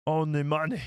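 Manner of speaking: slow, put-on Italian accent; hammed-up Italian accent; Italian-sounding Watto. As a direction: aim for Watto, land Italian. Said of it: "You sound Italian, [name], not Watto".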